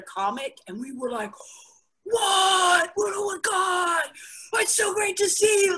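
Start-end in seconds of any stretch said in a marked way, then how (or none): 2.0-5.8 high gushing overexcited greeting; way too excited. Retold or imitated. Comic greeting an acquaintance with fake overexcitement. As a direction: squeal it like you're thrilled to see them.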